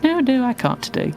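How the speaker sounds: high, light imitating of own refusal; playfully high no, no; high retold refusal. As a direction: squeak out a mock apology, high. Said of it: "and I was like".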